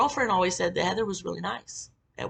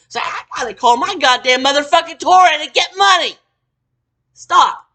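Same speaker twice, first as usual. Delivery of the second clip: loud, high sarcastic scorn; mock her then cut her off; loud scornful sarcasm; loud mockery; 8 semitones higher, 11 dB louder than usual. Mocking, then cutting her off.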